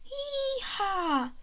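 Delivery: high hee-haw bray; donkey bray. Acted. Narrator voicing the donkey bray, high.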